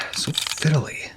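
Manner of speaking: grumbling to oneself over the fiddly claw; muttered under breath. Acted. Fumbling with the claw, he whispers a complaint to himself.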